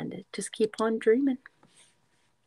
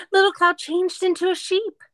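excited; 10 semitones higher, 4 dB louder than usual. Acted. Excited page turn reveal.

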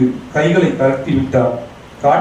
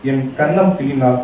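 plain reading voice

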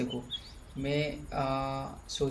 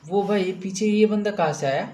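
startled exclaim; 5 semitones higher, 5 dB louder than usual. Real, not acted. Rival suddenly appears behind.

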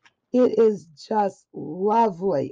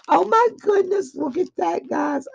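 high, excited gasp of delight; squealing oh my goodness; thrilled squeal; 9 semitones higher than usual. Real, not acted. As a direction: gush up high at the surprise. Thrilled at gift reveal.

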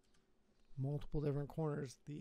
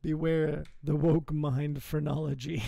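slow, much louder, declaiming mockery; mock booming announcer; 12 dB louder, slower than usual. Streamer mocking the narrator, booming slowly. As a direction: boom it out like a pompous narrator.

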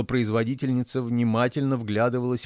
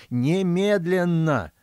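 high, drawn-out outraged demand; angry yell; 7 semitones higher, 4 dB louder, much slower than usual. A narrator's reading. Old man demanding passage, angry yell. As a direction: stretch the word out high and angrily. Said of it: "заорал старик".

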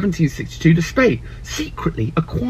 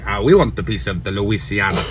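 put-on Spanish accent; 10 semitones lower than usual. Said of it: "the Spanish were like".

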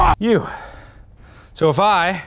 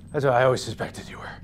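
whispering; 6 semitones lower, 8 dB quieter, faster than usual. Real, not acted. Taunting an enemy in game.

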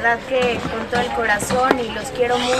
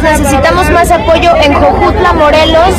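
much louder voice, projecting over the crowd; loud over the noise; 3 semitones higher, 9 dB louder than usual. Real, not acted. Announcing the aid truck to a noisy crowd, loud and projected.

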